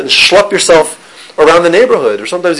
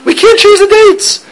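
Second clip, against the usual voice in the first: high, slow, raised-voice objection; incredulous protest; 4 dB louder, slower than usual. Acted. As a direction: cry out in exaggerated disbelief. Mock protest at shul's date change.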